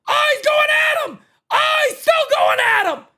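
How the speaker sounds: high-pitched, thrilled play-by-play yell; excited shouting. Real, not acted. Streamer watches manager confront umpire, excited yelling. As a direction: cry it out high as the argument escalates.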